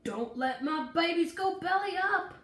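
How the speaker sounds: loud, high desperate howl; howled desperate cry; howling plea. A narrator's reading. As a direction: beg for the plants. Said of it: "howled Daddy".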